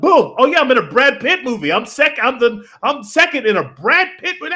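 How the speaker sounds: high, bursting excitement, acting the newcomer; mock amazed excitement. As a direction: play an actor thrilled at a big break. Voicing actor's sudden big break.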